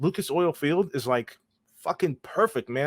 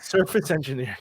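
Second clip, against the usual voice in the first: "Surface engineer" said through a grin; said smiling. Real, not acted. Co-host repeats the fancy job title, trying not to smile. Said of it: "I can't even say it without smiling".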